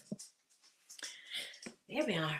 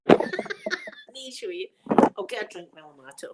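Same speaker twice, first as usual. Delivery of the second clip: ordinary talk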